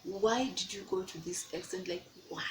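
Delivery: muttered self-reproach. Retold or imitated. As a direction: ask yourself quietly in disbelief. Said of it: "I'm like".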